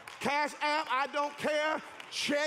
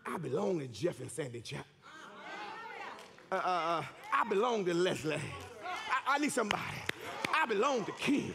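harvest voiced; 6 semitones lower than usual. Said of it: "your harvest is saying".

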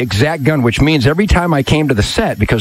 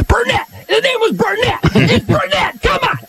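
high exasperated shouting; high mock-annoyed yelling. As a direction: yell the name like a fan correcting him. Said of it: "Linda's in Jackson right now going".

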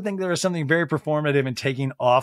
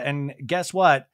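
usual speaking voice

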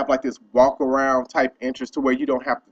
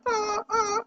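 startled grunts; 7 dB quieter than usual. Retold or imitated. Man reacts to being yelled at.

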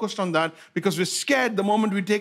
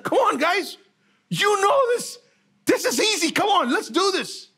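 higher, eager pep talk; cheering angels; 11 semitones higher than usual. Acted. Angels cheering on the frightened army, high and eager. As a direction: urge the troops on, much higher. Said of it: "The angels are like".

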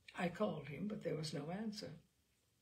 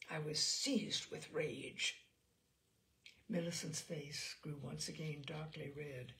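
ordinary delivery

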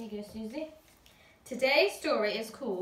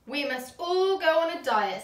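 a hint of a bossy mother, higher and louder; bossy mum; 5 semitones higher, 7 dB louder than usual. A narrator's reading. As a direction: announce it to the family. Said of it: "said Missus Large".

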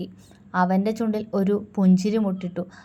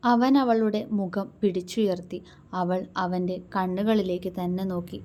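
plain reading voice

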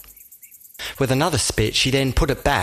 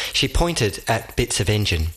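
ordinary delivery

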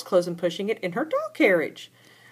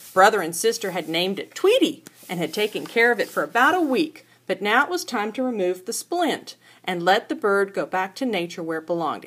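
usual speaking voice

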